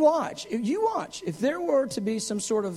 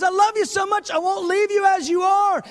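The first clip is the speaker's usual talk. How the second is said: loving shout; 10 semitones higher, 7 dB louder than usual. Retold or imitated. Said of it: "He goes".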